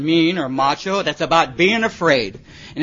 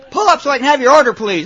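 fast, higher, louder impatient squawk; mock a snippy drive-thru clerk; irritated drive-thru voice; 6 semitones higher, 6 dB louder, faster than usual. Drive-thru speaker snaps at the stoned driver, fast and loud. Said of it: "He's getting mad at me".

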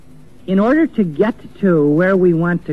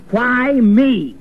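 angry protest; 6 semitones higher than usual. Retold or imitated. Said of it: "he goes into a stage of anger".

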